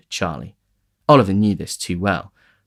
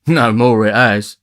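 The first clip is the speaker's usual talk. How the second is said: drawled agreement; 2 semitones higher, 7 dB louder, slower than usual. A narrator's reading. Charlie agreeing slowly.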